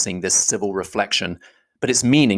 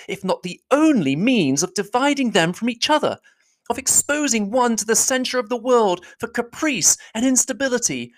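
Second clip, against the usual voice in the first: put-on higher young woman's voice, accusing; a higher heroine's voice pressing her charge; Elizabeth, indignant; 12 semitones higher than usual. Elizabeth confronting Darcy, higher and accusing.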